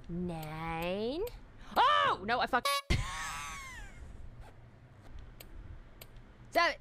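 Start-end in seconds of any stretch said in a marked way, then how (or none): 2.7-4.4 high squeaky laugh. Real, not acted. Laughing at own mistake.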